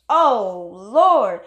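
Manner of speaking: a loud heartfelt cry of "Lord"; loud exclamation. Real, not acted. Exclaims in joy.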